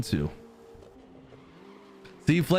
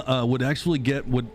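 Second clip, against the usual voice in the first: normal talking voice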